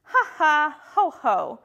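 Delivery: spoken ha-ha, high then dropping; mock-hearty knightly laugh; scoffing laugh. A narrator's reading. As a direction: laugh it off word by word. Small knight scoffs in rhyme.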